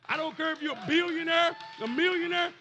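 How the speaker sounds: high, loud, defiant sermon; full-force preaching. Acted. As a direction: proclaim it boldly over the room.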